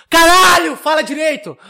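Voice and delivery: frustrated outburst. Real, not acted. Vlogger yelling at himself for tripping over the story. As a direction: snap at yourself for fumbling the words. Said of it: "scolding himself".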